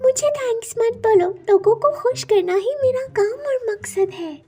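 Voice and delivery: high, put-on voice of a benevolent sandal; magical sandal. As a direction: announce yourself as an enchanted talking object.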